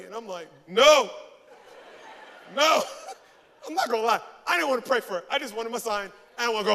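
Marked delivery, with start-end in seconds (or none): 0.7-2.8 loud, blunt mock-irritated no; exasperated outburst, played for laughs; stubborn refusal. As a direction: flatly refuse at full volume.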